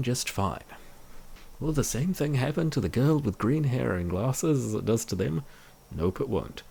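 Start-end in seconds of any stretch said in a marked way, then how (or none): none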